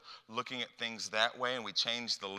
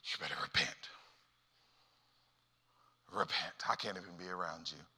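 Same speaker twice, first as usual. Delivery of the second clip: low, partly whispered disapproval; soft, disdainful aside; hushed judgment; 5 semitones lower, 7 dB quieter, faster than usual. Acted. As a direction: mutter it under your breath at a sinner. Hushed judgmental churchgoer acted out.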